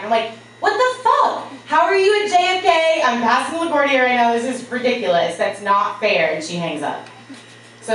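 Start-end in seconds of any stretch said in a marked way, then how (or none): 0.6-6.3 high outraged squeal; shrieking disbelief. Retold or imitated. Disbelief she's already at JFK.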